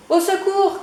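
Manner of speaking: high, loud cry for help; calling for help. Acted. Chick crying for help among the hens. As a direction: call out in alarm. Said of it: "crie Poussin".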